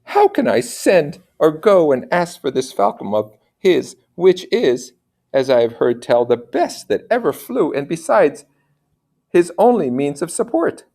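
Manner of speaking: noble lady thinking aloud, pitched high; a worried mother wondering to herself. Acted. Mother's inner thoughts.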